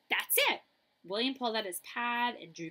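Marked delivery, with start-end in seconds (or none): 0.1-0.6 high, loud burst of discovery; light up as the answer hits you; sudden idea. Acted. William realises how to solve the umpire problem, excited.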